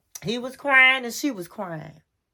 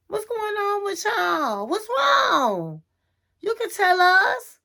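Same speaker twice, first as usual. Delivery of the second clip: worried friends; 5 semitones higher than usual. Retold or imitated.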